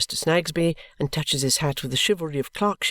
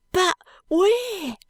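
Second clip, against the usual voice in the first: very high, desperate cry; crying boy. A narrator's reading. Ragged boy begging the constable, high and crying. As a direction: wail out the question in despair. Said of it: "cries the boy".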